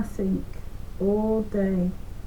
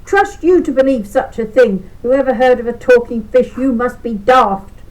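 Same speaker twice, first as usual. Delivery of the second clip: loud, scornful scolding, pitched up; shouting wife; 3 semitones higher, 10 dB louder than usual. A narrator's reading. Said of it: "she just shouted at him".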